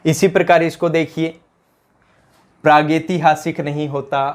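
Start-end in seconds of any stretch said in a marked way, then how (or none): none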